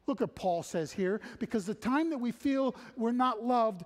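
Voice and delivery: high, loud, driving sermon voice; full-force preaching. Acted. Preacher urging the congregation that God moves first, full force.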